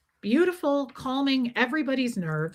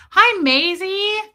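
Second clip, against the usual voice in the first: high, loud, drawn-out pet greeting; singsong hello to a pet; cooing greeting; 8 semitones higher, 7 dB louder, much slower than usual. Real, not acted. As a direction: coo slowly to a beloved pet. Greeting the pet mid-story.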